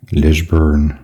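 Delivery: "Lisburn" said the Northern Irish way, as the locals would; a rough Northern Irish "Lisburn"; Northern Irish accent attempt. Shown as a demonstration. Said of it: "I can't do a Northern Irish accent".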